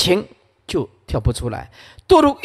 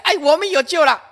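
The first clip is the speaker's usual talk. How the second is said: high, overjoyed cry of relief; joyful frog; 10 semitones higher, faster than usual. Acted. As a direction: burst out like a thirsty frog finding water. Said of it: "这个假青蛙看到是水了". (兴奋高呼，喜出望外)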